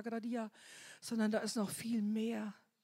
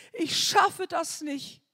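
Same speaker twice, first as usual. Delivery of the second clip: pleading cry; 5 semitones higher, 7 dB louder, slower than usual. Retold or imitated. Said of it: "Und dann schreie ich aus".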